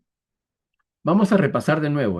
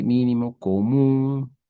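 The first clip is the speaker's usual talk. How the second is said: mínimo común, slowly, with the U of común drawn out; mínimo común in slow, deliberate syllables; stress on accented syllables; much slower than usual. Shown as a demonstration. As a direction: stretch the stressed MÚN in común. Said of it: "acento en la U".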